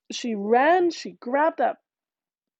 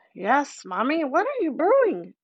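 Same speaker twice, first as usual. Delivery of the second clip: eager fairy baby in a lightly voiced old man; a hint of an old man, eager; 6 semitones higher, 3 dB louder than usual. A narrator's reading. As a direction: press the question like a greedy little ancient. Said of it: "in his old man voice".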